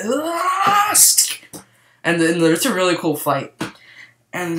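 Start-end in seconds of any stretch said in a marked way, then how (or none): 0.0-0.9 mock pained yelp. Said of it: "and he's like".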